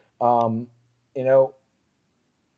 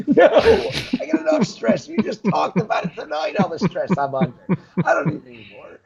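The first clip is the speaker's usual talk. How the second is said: higher, laughing through it; laughing no, higher pitch; laughing refusal; 8 semitones higher than usual. Real, not acted. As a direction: laugh off the idea. Laughing refusal of weekly show.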